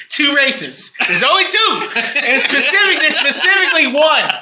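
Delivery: high, laughing while talking; giggling through it. Real, not acted.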